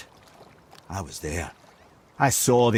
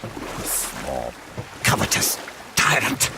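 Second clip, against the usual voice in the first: bitter aside; 10 semitones lower, slower than usual. Acted. Bitter aside about Odin.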